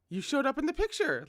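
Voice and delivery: high, bright delighted exclaim; weirdly happy. A narrator's reading. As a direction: gleefully point it out. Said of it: "Lin Jing was weirdly happy".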